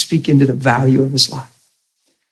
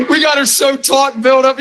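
laughing; 11 semitones higher than usual. Real, not acted. Laughing retelling of woman's reaction.

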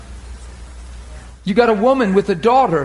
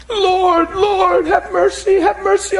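higher, crying plea for mercy; pleading cry; 7 semitones higher, slower than usual. Acted. Mother pleads with Jesus for mercy, crying high. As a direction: beg for your son, near tears.

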